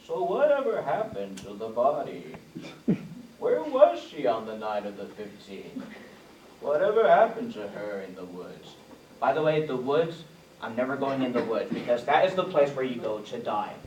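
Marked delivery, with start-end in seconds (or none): 0.1-8.7 soft, hushed storybook questions; Keith Morrison questions. Retold or imitated. Comedian imitating the Dateline host asking questions, soft and hushed. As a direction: ask like a children's show host, gently. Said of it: "the way he asks the questions".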